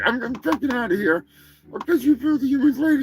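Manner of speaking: high, hurried turkey-robot voice; huffy Turkinator turkey. Acted. Turkey announces its exit to the host, high and huffy. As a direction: storm off in a high cartoon voice.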